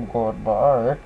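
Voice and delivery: ordinary talk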